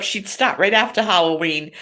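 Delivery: ordinary talk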